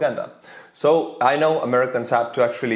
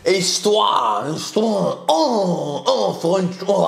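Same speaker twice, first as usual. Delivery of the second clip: a hint of a swooning Frenchman, histoire breathy and high; teasing the French ball; mock French swoon; 5 semitones higher, slower than usual. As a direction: sigh histoire like a lovestruck fan. Mocking the French character.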